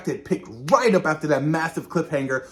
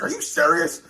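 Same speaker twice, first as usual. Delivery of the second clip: Oz upset; 6 semitones higher than usual. Retold or imitated. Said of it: "He's like".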